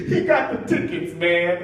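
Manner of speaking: chuckle, then talk through it; imitated celebrity laughing. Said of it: "He came like this".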